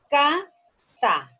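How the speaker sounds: "casa" in two parts, a loud CA, then sa; syllable by syllable. Shown as a demonstration. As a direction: punch CA in "casa", pause, then sa. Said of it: "¿dónde suena más fuerte?".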